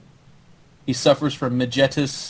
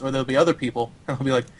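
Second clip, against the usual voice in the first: ordinary talk, nothing marked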